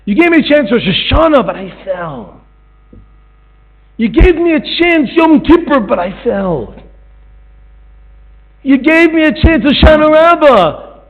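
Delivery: high, loud pleading cries; fervent preacher's plea; preaching at full force. Real, not acted. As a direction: cry out each missed chance. Preaching climax to God.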